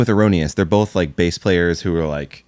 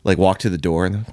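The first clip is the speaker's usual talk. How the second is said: normal talking voice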